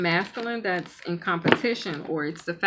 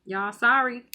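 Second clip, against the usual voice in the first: normal talking voice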